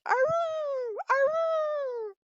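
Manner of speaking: loud high howl calling back; answering wolf howl. A narrator's reading. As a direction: howl back hard so the pack hears you. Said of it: "And so he answered".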